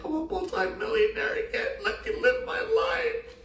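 high, laughing boast that slows at the end; crowing about his success through giggles. Real, not acted. Laughing to Susie about proving himself to his parents.

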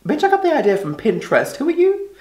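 high, indignant retort rising at the end; sassy comeback. Acted. Answering a coworker who policed her outfit, high indignant sass. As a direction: push the pitch up, affronted.

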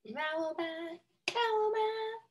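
high, light imitation of the song's "Vowel bat"; imitating the singer. Teacher copying how the song's singer says it. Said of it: "That's how she says it".

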